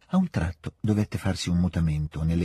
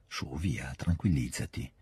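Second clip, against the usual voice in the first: soothing; 5 semitones lower, 7 dB quieter, slower than usual. A narrator's reading. Prince calms the princess.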